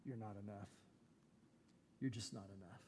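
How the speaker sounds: low, soft, flat rejection; a coveting heart telling God quietly that He is not enough; cold dismissal. Acted. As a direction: say it quietly and low, as if shutting someone out. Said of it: "You're saying to God".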